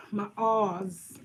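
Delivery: put-on Boston accent with dropped R; fake Boston accent. Shown as a demonstration. Streamer showing viewers her R words, fake Boston accent. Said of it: "Let me stop faking".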